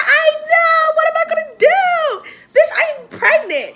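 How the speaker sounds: telling her friend she's pregnant, shrieking; panicked shriek. Retold or imitated. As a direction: shriek it like you're freaking out.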